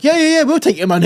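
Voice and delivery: high, fast, eager agreeing; mock eager. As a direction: rattle it off greedily. Mock officials greedy quote.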